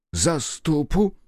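slow, high, disbelieving priest; the priest dragging out his doubt in a high voice; incredulous priest. Acted.

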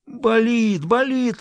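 high howling cry. A narrator's reading. Governor howling at the girls, high and pained. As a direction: howl it in pain. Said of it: "выл, тряся головой".

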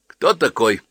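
brusque. A narrator's reading. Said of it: "бесцеремонно спросила она".

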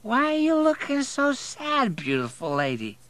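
slurred, sloppy concern; incoherent drunk. A narrator's reading. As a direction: croon it drunkenly up high. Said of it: "he's nearly incoherent".

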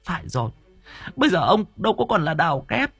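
very high-pitched outraged wife's cry; shrieked reproach, wounded and furious; near screaming. A narrator's reading. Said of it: "bà nói như hét". (nói như hét, phẫn uất)

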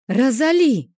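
loud, high call; hollering. A narrator's reading. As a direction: yell for your friend. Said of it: "заголосила я".

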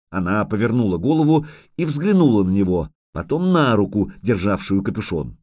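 ordinary delivery

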